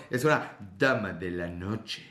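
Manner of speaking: low, slow sultry purr; low sultry lady of the night; sensual. Acted. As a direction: breathe it out seductively. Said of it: "empiezo a emocionarme de que habla así".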